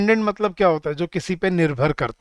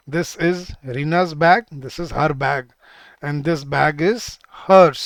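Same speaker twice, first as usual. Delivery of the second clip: very slow, each word spaced for writing; slow dictation pace for students; much slower than usual. Shown as a demonstration. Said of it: "पहले एक बार लिखवा देता हूँ".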